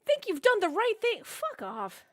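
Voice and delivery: high, loud sarcastic jab then curse; mock the decision bitterly; sarcastic outburst. Real, not acted. Angry retort to the team call.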